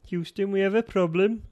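high-ish, shaky-accuracy Scouse key phrase; Liverpudlian. Shown as a demonstration. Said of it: "that was Liverpudlian".

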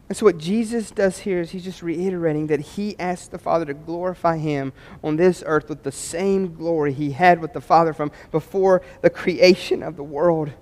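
ordinary talk

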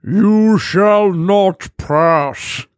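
very slow, drawn-out wizard voice; Gandalf impression. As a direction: stretch every word like an old wizard. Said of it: "Give us that accent".

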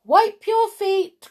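high, loud, drawn-out call; mother calling out to her child. A narrator's reading. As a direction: call an order through the house. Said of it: "called her mother".

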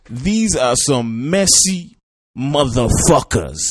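loud, high, stretched-out yell; scandalised shout. Acted. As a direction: holler the verdict slowly, relishing every word. Shouted verdict on played clip.

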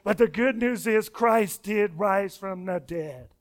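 ordinary talk, nothing marked

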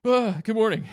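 high-pitched startled yelp into a greeting; mock-startled, jumping up in pitch. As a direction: imitate someone caught in the act. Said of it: "he was like".

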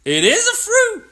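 high, excited exclamation; triumphant. Real, not acted. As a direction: crow it, pitch shooting way up. Triumphant at the search result.

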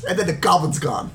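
usual speaking voice

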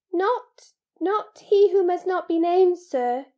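high-pitched elf, halting start; falter, then stress it in a high voice; Dobby, hesitant. Acted. House-elf hints an answer to Harry, hesitant.

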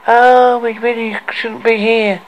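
put-on a nervous young boy fretting; Torty's boyish voice. A narrator's reading. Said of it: "with a boyish voice".

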